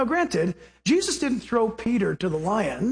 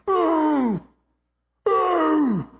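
lion roar imitation; 6 semitones higher than usual. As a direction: growl out a lion's roar. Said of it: "And he's going".

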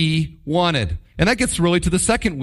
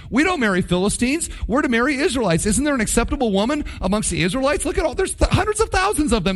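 high, fast, pleading disbelief; dismayed parents; 7 semitones higher, faster than usual. Acted. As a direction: voice the stunned parents rising to exasperation. Parents react to son's marriage.

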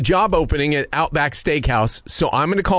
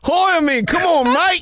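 put-on Australian accent; 10 semitones higher than usual. Prank caller begging the manager for the job, Australian accent. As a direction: beg for the job like a cheery Aussie. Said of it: "I do a very good Australian accent".

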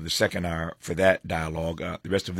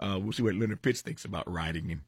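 ordinary talk

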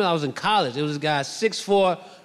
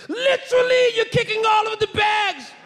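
very high, hammed-up British yell; outraged British security guard, badly faked. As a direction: shriek it in a bad British accent. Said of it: "He's yelling at me in British".

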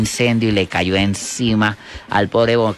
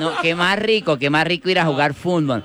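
high, eager put-on voice; cleft-lip boy caricature; 7 semitones higher than usual. Boy delighted to play football, high cleft-lip voice. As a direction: play the boy keen, pitched up. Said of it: "un boquinchito que iba a".

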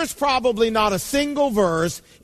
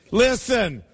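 normal talking voice

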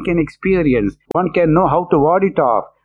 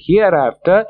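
ordinary talk, nothing marked

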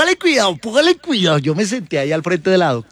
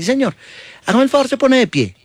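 doctora imitada; 7 semitones higher than usual. Retold or imitated.

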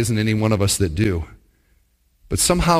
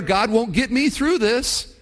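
a hint of despair, higher and slowed; 9 semitones higher than usual. A listener voicing their despair, mildly pleading. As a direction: let the hope drain out, lightly. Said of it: "I could give in to despair".

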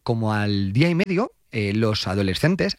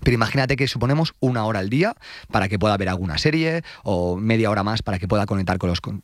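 ordinary talk, nothing marked

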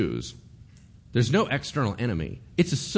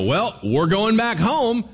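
defiant crowd; 11 semitones higher, 7 dB louder, slower than usual. Retold or imitated. Nation walking out on king.

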